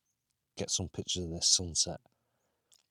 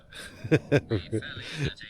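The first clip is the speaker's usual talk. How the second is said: tired giggle; 8 semitones higher than usual. Real, not acted. Tired bedtime giggle in tent.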